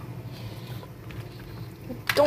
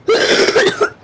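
gagging cough; 11 semitones higher, 8 dB louder than usual. Real, not acted. Gags on a bad bean.

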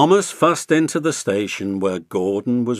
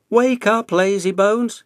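high, loud teasing call; cheeky little engine; 9 semitones higher, 5 dB louder than usual. A narrator's reading. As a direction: needle Gordon awake. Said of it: "he whistled".

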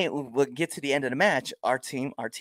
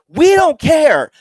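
loud, high, drawn-out jeer; callous shout; 12 dB louder, much slower than usual. Retold or imitated. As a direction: bellow it out mockingly, each word stretched. Callous shout back at the mother.

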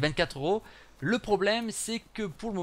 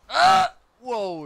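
loud, high startled cry; startled yelp; 7 semitones higher, 11 dB louder than usual. Real, not acted. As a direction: burst out in real shock at a glitch. Startled by a big lag spike. (cri de surprise, aigu et fort)

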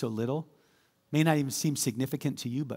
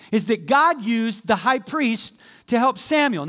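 higher, insistent preacher voice; full-force preaching; 6 semitones higher, 5 dB louder than usual. Acted. Pastor listing God's commands, insistent preaching. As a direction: hammer each point home.

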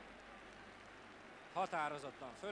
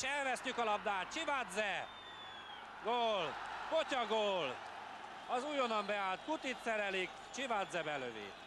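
excited commentary; 6 semitones higher than usual. Real, not acted. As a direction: cry out the goal in dismay. Opponent scores, commentator cries goal.

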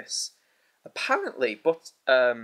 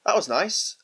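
sarcastic; 7 semitones higher, 4 dB louder, slower than usual. Real, not acted. After sulfur rotten egg bean.

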